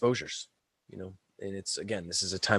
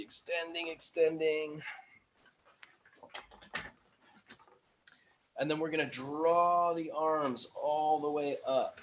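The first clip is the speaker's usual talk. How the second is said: strained and high on a held breath; holding breath; 5 semitones higher, 3 dB quieter, slower than usual. Real, not acted. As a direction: push it out while holding the breath. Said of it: "holding that breath".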